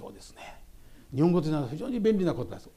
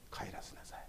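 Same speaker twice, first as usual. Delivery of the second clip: whisper; 19 dB quieter than usual. Retold or imitated. Jesus' quoted command.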